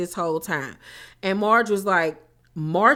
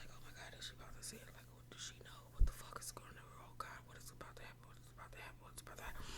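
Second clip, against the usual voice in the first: frantic fast whisper; panicked whisper; 26 dB quieter, much faster than usual. Retold or imitated. Recapper voicing Jackie panicking in a fast whisper. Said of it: "Jackie started panicking".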